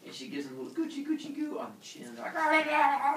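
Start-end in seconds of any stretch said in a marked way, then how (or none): 2.3-3.2 put-on high, loud baby monster cries; monster baby yells. Giant hundred-handed babies yelling as they run around. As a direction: bellow like a giant toddler.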